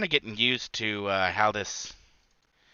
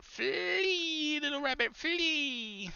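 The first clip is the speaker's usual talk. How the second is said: high, held mock-dramatic wail; hammed-up theatrical call; dramatic cry; 3 dB quieter, much slower than usual.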